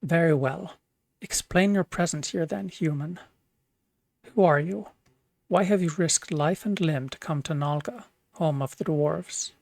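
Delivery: dwarf king questioning sternly; demanding dwarf king. Acted. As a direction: interrogate the intruders.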